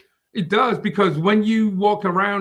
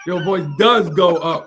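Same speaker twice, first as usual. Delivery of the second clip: "does" hit louder with laughter; stress on DOES; 5 dB louder than usual. Real, not acted. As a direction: hammer "does" as a laughing correction. Repeats the correction, laughing.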